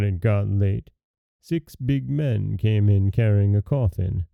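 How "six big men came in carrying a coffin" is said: ordinary delivery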